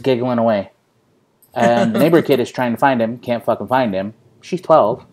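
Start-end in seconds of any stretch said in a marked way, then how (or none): none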